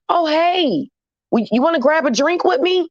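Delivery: high, put-on sociable voice; acted friendly invitation. Men she texted invite her for a drink, acted friendly and high.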